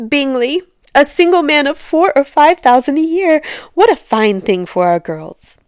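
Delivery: high, loud, excited wife voice; delighted scheming wife. A narrator's reading. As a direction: gush about a rich catch for the daughters. Wife delighted by rich bachelor.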